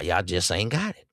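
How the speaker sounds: ordinary talk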